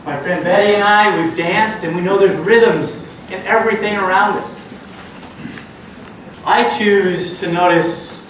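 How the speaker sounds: ordinary talk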